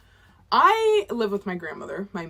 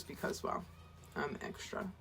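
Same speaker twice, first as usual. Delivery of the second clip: whispered, softer confession; whispered aside; 5 semitones lower, 15 dB quieter than usual. Real, not acted. Vlogger admitting she's extra, whispered aside. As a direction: let it slip as a secret.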